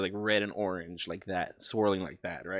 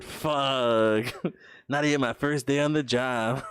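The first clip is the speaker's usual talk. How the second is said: loud, drawn-out cry of dismay; loud comic lament with a laugh; mock outraged wail; 4 semitones higher, 5 dB louder, slower than usual. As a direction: wail it out in playful despair. Playful outburst after "You're fired.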